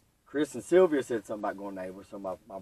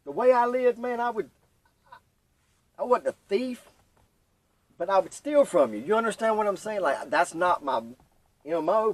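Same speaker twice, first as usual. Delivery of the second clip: emotional confession; 9 semitones higher, 5 dB louder than usual. Real, not acted.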